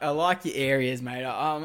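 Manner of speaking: ordinary talk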